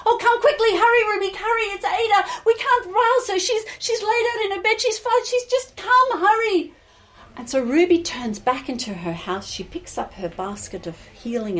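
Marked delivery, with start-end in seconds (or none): 0.1-6.7 high, urgent panicked plea; distressed, out of breath. Acted. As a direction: beg her to come at once, frantic. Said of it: "you're looking so distressed".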